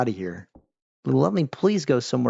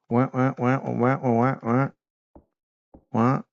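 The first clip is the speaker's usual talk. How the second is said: repeated short wah sounds; mock wah-wah sound; imitated "wah wah" noise. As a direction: mouth a run of wahs like a noise effect. Imitated noise while stuck.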